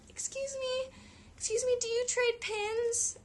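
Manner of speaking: put-on chirpy little girl asking politely. Imitating the seven-year-old girl asking to trade, high and childlike. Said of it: "she said".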